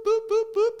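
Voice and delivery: high, even siren boops, pitched very high; imitated siren beeps. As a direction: mimic a police siren whoop in quick beeps. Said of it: "hitting the siren on the R M P".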